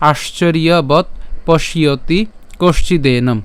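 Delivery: আশ্চর্যবৎ পশ্যতি কষচিদেনম in separate, clear word groups; Sanskrit recitation demo. Shown as a demonstration. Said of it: "প্রথম থেকে যদি আবার বলি". (আশ্চর্যবৎ পশ্যতি কষচিদেনম থেমে থেমে উচ্চারণ)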